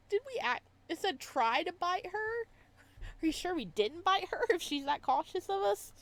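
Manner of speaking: can't believe what you're hearing; half-laughing incredulity; incredulous. Real, not acted. Player reacts incredulously.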